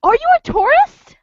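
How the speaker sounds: mock curious local; lightly hammed stranger; stranger asking.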